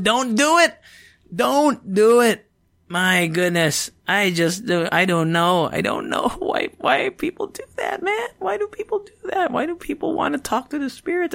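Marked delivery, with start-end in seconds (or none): 0.0-0.8 higher, louder warning; Don't do it, pushed up high; rising warning. Pretend. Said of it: "and say". 1.3-2.5 drawn out, high, loud; slow loud warning. Pretend. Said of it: "and say". 6.8-11.3 high whiny disbelief; mock wail. Real, not acted.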